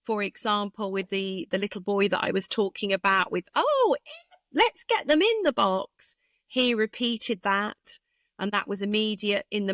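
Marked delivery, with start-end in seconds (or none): 3.5-6.1 high, singsong exclamation, drawn-up "Oh"; echoed child's script. Retold or imitated. Therapist quoting little boy's script, high-pitched and singsong.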